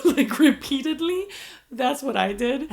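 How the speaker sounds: words broken by laughter, high; laughing. Real, not acted. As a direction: giggle through the words. Laughing at her own story.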